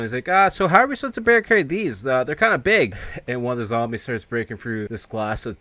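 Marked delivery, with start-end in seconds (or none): 0.3-2.9 worried character voice. Retold or imitated. Character worried about big windows.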